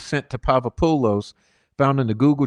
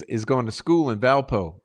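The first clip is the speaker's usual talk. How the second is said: usual speaking voice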